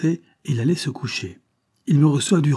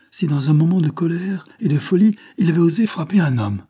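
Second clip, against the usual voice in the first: higher, louder, impassioned question; agitated confession; 7 semitones higher, 5 dB louder than usual. A narrator's reading. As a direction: build urgently towards the admission. Agitated man leads to confession. (aveu exalté et pressant)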